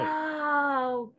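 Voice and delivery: ordinary talk, nothing marked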